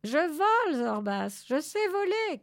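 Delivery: high, loud joyful shout; euphoric cry. Acted. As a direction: cry out in pure joy from the sky. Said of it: "criait-elle, euphorique". (cri euphorique, très aigu et fort)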